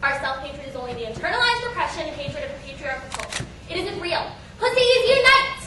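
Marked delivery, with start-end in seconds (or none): 4.6-5.6 high, loud slogan shout; rallying cry. Acted. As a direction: cry it out like a protest chant. Mock rallying cry to the audience.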